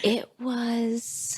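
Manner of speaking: hushed, voiceless reverence; very soft breathy whisper; awed whisper. Acted. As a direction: whisper it, mock-awestruck. Echoing the imitated whisper in awe.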